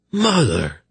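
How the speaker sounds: high, astonished exclamation; shocked gasp. A narrator's reading. King realizing his mother's secret, gasping in shock. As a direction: cry it out in sudden shock. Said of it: "he gasped".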